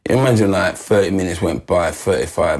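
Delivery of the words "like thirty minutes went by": ordinary talk, nothing marked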